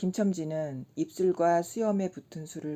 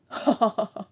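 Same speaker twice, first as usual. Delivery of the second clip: put-on drunken laugh; 5 semitones higher, 6 dB louder than usual. A narrator's reading. Laughing at his own story.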